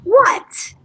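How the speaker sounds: sharp high what; startled, voice jumping up; shocked. Acted. Shocked reply to husband.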